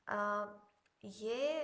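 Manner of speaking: ordinary talk, nothing marked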